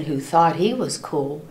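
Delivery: usual speaking voice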